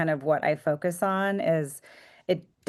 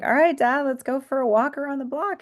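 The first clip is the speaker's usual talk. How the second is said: bright, higher and louder cheerful call to dad; cheerful invitation; 9 semitones higher, 4 dB louder than usual. Retold or imitated. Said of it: "I was like".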